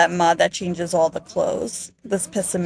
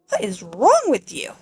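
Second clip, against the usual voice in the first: normal talking voice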